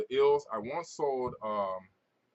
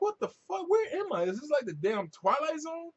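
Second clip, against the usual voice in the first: much higher incredulous outburst; retold disbelief. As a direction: act baffled as if lost in a strange world. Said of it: "And I'm like".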